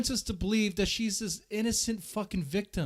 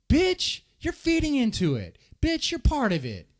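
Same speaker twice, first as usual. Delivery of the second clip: angry retort; 9 semitones higher, 4 dB louder than usual. Retold or imitated. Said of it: "I'm like".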